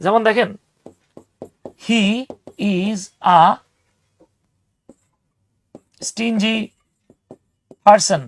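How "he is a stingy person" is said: "He is a stingy person" with each word split off by long pauses. Shown as a demonstration. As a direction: say every word apart, no linking. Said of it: "এই যে he is a না বলে".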